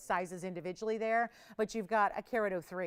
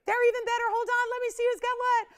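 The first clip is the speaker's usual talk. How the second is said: high, loud, squealing price countdown; excited countdown; 13 semitones higher, 6 dB louder than usual. Real, not acted. Host to viewers dropping the price, squealing with excitement. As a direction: shriek the prices down like a game show win.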